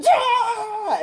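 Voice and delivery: very high, drawn-out mock yell; imitated battle yell. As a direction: yell like a hero straining in a fight. Spider-Man yells while spraying.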